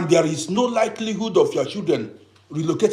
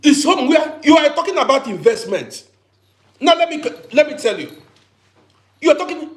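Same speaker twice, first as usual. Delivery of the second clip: louder and higher, with a burst of laughter; heated outburst; 7 semitones higher, 5 dB louder than usual. Real, not acted. Vlogger mocking talk of investing in Africa, loud and scornful. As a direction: scoff as you drive the point home.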